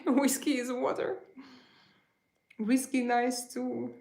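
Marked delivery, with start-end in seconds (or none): none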